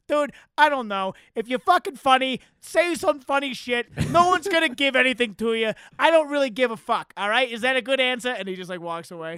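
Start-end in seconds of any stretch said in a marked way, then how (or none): 0.1-8.3 retold Bill Burr. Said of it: "He's like".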